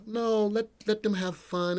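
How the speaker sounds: quiet, high mock coaxing; indulgent wife. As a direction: plead gently on the kids' behalf. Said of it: "And it's always like".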